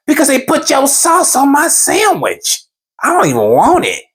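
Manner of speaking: high, drawn-out mock complaint; imitated high-pitched grievance; complaining wife. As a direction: whine it out as an aggrieved wife. Retold wife complaining about sandwich.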